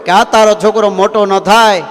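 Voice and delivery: the sage telling the maharaja to help the poor woman, high and firm; bold advising sage. Acted. Sage boldly advises the king.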